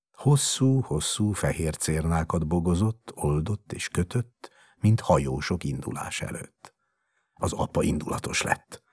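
plain reading voice

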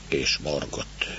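usual speaking voice

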